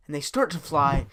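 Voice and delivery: ordinary delivery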